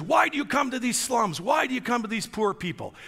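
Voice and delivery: high-pitched, agitated demand; indignant questioning. Retold or imitated. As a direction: imitate an exasperated man challenging a visitor. Acted angry challenge from official.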